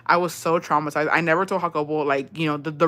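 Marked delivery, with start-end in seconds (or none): none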